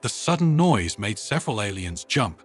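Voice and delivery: ordinary delivery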